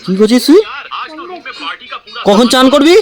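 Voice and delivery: loud, high-pitched parental telling-off; stern scolding. Real, not acted. Parent scolding child over videos. (চড়া গলায় বকুনি)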